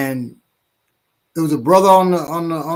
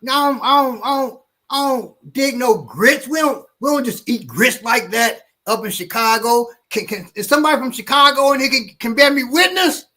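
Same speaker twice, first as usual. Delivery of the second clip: bus brother's rant; 9 semitones higher than usual. Retold or imitated. Man on bus ranting about Georgia grits, hammed-up high voice. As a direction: play the fired-up Chicago guy ranting. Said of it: "he was like".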